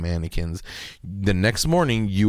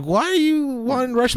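mom voice; 14 semitones higher than usual. Retold or imitated.

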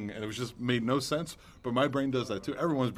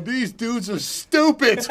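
laughing agent; 7 semitones higher, 6 dB louder, much slower than usual. Retold or imitated.

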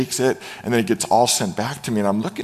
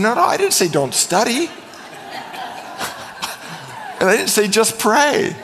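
higher-pitched, exasperated mock denial; retold protest; 9 semitones higher than usual. Youth pastor answering an accusing parent, protesting. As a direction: protest the misquote for laughs. Retelling his defense to parent.

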